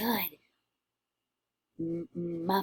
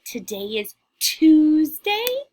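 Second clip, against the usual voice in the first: slow, louder little witch voice; drawn out and loud, like a little witch; slow witchy model line; 8 semitones higher, 9 dB louder, slower than usual. Shown as a demonstration. Teacher modelling a witch voice for the class, slow and loud. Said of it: "Can you say".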